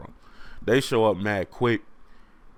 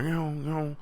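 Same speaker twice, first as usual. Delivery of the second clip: ordinary delivery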